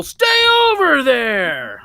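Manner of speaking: high, drawn-out comic yell; mock yell. Masked man keeps people away, mock yell. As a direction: stretch it out like yelling a warning across a distance. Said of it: "yell at people".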